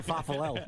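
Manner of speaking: "fafalel" with the consonants swapped; garbled pronunciation. Shown as a demonstration. Caller admitting he can't say it, "fafalel" muddled. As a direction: stumble through "fafalel" for falafel. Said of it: "I can't say fafalel".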